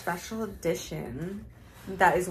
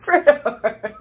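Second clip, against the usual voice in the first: laughing; 3 semitones higher, 12 dB louder than usual. Real, not acted. Laughing at the name.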